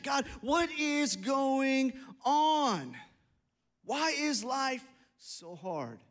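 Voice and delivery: very slow, stretched-out lament; exasperated plea. Acted. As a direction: drag the words out, pleading with God. Acted complaint to God.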